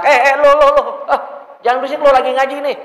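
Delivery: loud, high, fast rough shout; harsh rude scolding. Retold or imitated. Teacher barking at noisy kids, loud fast high harsh shout. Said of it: "Enak gak dengernya?".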